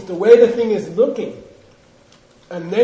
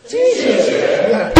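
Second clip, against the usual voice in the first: with some heart; 2 semitones higher, 7 dB louder, much slower than usual. Shown as a demonstration. Teacher demonstrating the debate cry with some heart. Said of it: "Say it with some heart".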